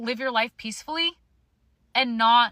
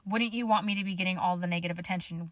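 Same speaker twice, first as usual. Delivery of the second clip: usual speaking voice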